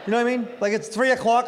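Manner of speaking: usual speaking voice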